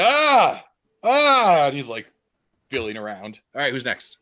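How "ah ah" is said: loud, much higher cries of pain; blinded villain cry. Acted. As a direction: yelp as the flash blinds him.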